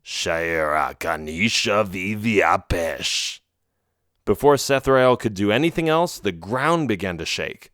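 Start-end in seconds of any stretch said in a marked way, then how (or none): 0.0-3.4 low, slow summoning chant; deep, drawn-out foreign incantation; troll incantation. A narrator's reading. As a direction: intone a spell slowly in a dark creature voice. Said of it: "said a few words in a foreign language".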